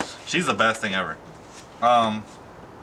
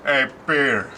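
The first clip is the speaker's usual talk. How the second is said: Joe impression; 3 semitones higher, slower than usual. Shown as a demonstration. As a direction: call to the neighbour with a scrunched face. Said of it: "the only way I can do Joe".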